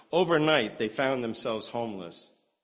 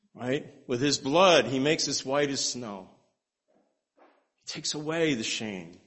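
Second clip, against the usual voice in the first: earnest preaching, rising on grace; 2 semitones higher than usual. Acted. Preacher exalting God's grace to the congregation, earnest preaching. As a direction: carry the room with it, fervent.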